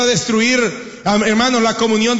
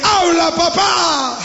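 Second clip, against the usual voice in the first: shouted plea; 4 semitones higher, much slower than usual. Retold or imitated. Wife to silent husband, shouted plea to talk.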